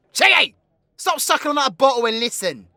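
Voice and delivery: high, loud, rapid scolding; impatient shout. Acted. Outlaw calling a drinking friend to listen, impatient shout. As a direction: yell to get a drinker's attention.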